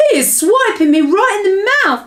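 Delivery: high, loud indignant yelp. A narrator's reading. Giant woken by a punch in the mouth, yelped. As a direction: yelp as if just hit. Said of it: "yelped the meat jipper".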